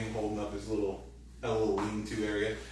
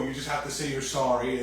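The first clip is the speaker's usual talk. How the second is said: ordinary talk, nothing marked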